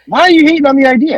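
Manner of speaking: high, loud, quick whine of protest; indignant protest. Real, not acted. Wrestler defending his idea to a doubting co-host, indignant protest. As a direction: raise it high and fast, protesting the criticism.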